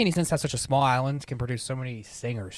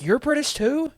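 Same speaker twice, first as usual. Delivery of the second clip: high, loud incredulous question; mock disbelief; 14 semitones higher, 5 dB louder, slower than usual. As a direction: squeak it out in exasperated surprise. Acted shocked reaction to hearing interview.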